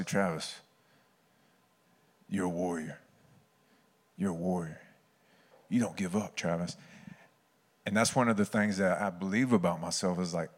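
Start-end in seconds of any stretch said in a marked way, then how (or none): 0.0-6.8 low, soft, sincere encouragement; solemn affirmation. Retold or imitated. Coach affirming his player, low and sincere. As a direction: speak it quietly into the listener.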